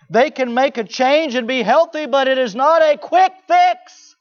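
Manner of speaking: fiery sermon shout; preaching shout. Acted. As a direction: hammer it home to the congregation. Preaching at full force.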